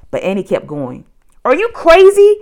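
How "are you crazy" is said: loud, high alarmed yell; calling out. A narrator's reading. Jack yelling at Annie on the ground, loud call. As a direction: call after her in alarm. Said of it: "Jack called".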